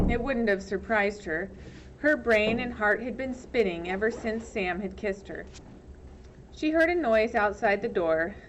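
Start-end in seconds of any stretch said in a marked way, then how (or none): none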